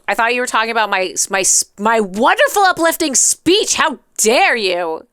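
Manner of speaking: ordinary talk, nothing marked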